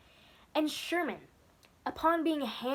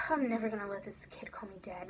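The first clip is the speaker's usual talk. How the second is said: a whispered private vow; 2 semitones lower, 7 dB quieter than usual. Retold or imitated. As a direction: whisper it to himself after a sigh. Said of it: "He was like".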